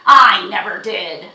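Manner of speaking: very loud, slow snarl. A narrator's reading. Horse owner denying his promise to the girl, snarling. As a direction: snarl the denial, drawing it out. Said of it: "snarled Jiggy".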